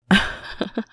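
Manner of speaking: bright flustered giggle; giggle, caught off guard; breathy giggle. Acted. Flustered by his sleepy voice.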